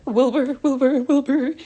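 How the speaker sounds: high, loud calls of Wilbur; urgent calling. Retold or imitated. Flick calling out to Wilbur in panic. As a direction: call out to him in alarm. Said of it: "Flick was like".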